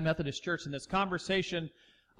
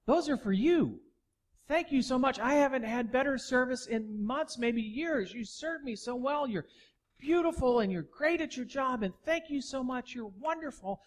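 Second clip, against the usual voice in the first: higher, eager no no no; higher-pitched eager gratitude; insistent thanks; 6 semitones higher than usual. Retold or imitated. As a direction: press the gift on her warmly. Preacher insisting the tip is hers.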